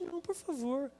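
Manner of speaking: a soft, polite please; gently asking. Retold or imitated. Changed man politely refusing the squeegee, soft. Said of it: "Eu só peço gentilmente".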